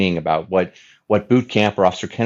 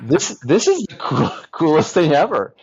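laughing through the words, higher; giggling while gushing; 9 semitones higher than usual. Real, not acted. Guest laughs telling host about his dream job, laughing.

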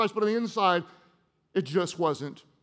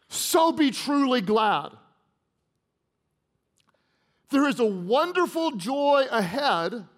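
slow, raised, preaching the verse; preacher's scripture reading at full force; fervent scripture reading; 3 semitones higher than usual. Acted. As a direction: proclaim it, rising to a shout on faith.

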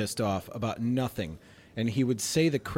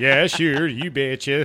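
put-on Scandinavian-Midwestern take on a Swedish accent; hammed-up Swedish "ya sure" voice; mock Swedish accent; 4 dB louder, slower than usual. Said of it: "Do the Swedish voice".

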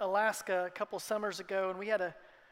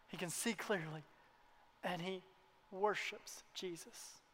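hushed awe; 3 semitones lower, 10 dB quieter, slower than usual. Acted. Quiet climax of the story.

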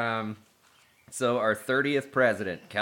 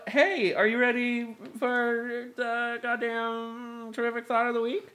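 announcer voice; 11 semitones higher, 10 dB louder than usual. Acted. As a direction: announce it like a show host. Announcing segment title.

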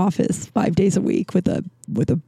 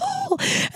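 high squeaky burst of laughter; a helpless high-pitched laugh; real laugh; 8 dB quieter than usual. Real, not acted. Remembering the call, bursting into high laughter. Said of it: "I was laughing so hard".

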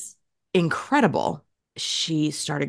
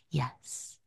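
tiny, hushed reply; very quiet; 13 dB quieter than usual. Retold or imitated. Toddler sleepily answering mom's question, very quietly. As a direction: answer very softly, barely audible. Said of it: "Very quietly".

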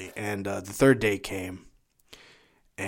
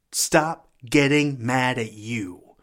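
slow, hammered start building to loud, high preaching; rally them to righteous anger; preacher's building charge, each phrase driven home; fired-up exhortation; 4 semitones higher, 4 dB louder than usual. Real, not acted.

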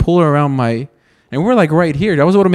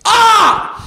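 shocked yelp. Real, not acted. Friend's overdone line mocked.

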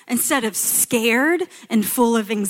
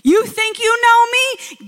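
much higher, slow, teasing challenge; Jesus challenging; 9 semitones higher, slower than usual. Acted. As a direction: ask it like Jesus grinning at his friends. Jesus voiced in sermon.

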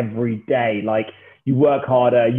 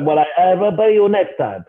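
higher put-on Russian accent; badly faked Russian accent, pitched up; 4 semitones higher than usual. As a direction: imitate the Russian guy dodging, in mock accent. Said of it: "This accent's getting a little bit worse".

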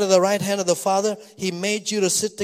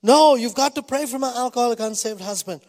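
higher, louder imitated plea; tearful pleading; 5 semitones higher, 4 dB louder than usual. Woman begs pastor to pray for her husband, imitated tearful pleading. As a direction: insist like a distraught wife, lightly acted. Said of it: "She looked at me with tears".

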